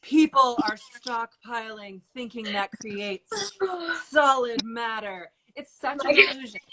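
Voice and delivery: mock-epic movie voice-over. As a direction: narrate it like a film trailer. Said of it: "You do that in your movie voice".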